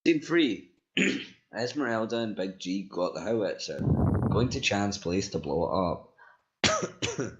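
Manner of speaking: ordinary delivery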